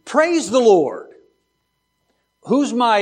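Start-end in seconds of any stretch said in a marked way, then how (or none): 0.0-1.1 higher, slow cry of praise; demonstrated exclamation, drawn out and raised. Shown as a demonstration. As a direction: proclaim it with an exclamation point. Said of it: "Exclamation point".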